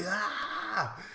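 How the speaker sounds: hammed-up effort scream. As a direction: imitate straining to hold her. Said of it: "he screams like".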